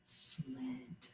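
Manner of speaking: soft unvoiced whisper of "sled"; whispered blend. Shown as a demonstration. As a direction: breathe "sled" out quietly.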